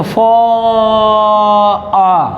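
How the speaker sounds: "फॉ-" held very long, then "आ"; drawn-out vowel. Shown as a demonstration. Teacher showing where one sound falls, "फॉ-" drawn out. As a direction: stretch the vowel of "फॉ-" on a steady note. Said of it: "अब देखो".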